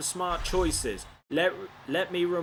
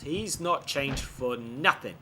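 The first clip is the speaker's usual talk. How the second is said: ordinary delivery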